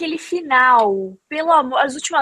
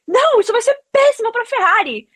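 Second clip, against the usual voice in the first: retold yell; 9 semitones higher than usual. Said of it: "Aí ele começou a gritar comigo".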